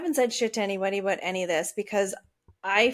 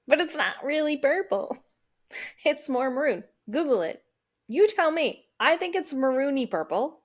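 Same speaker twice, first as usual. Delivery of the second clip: insistent protest; 5 semitones higher than usual. Retold or imitated. Arguing over the car's colour.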